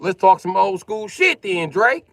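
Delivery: high, loud, slow call-out to Drake; loud challenge. Real, not acted. To a viewer named Drake in chat, a high, loud challenge. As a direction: throw it back at him, loud and slow.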